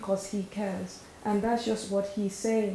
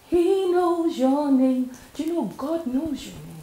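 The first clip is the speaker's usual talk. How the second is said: emphatic declaration; 6 semitones higher, 6 dB louder than usual. Acted. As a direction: lift the voice to announce it. Emphatic reassurance in sermon.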